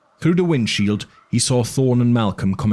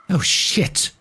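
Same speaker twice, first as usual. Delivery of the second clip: whispered curse; 10 semitones higher than usual. A narrator's reading. Eddie curses in alarm.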